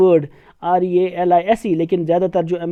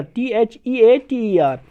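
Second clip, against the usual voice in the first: slow letter-by-letter dictation; slow teacher spelling; much slower than usual. Shown as a demonstration. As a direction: spell each letter slowly. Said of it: "American में लिखा है".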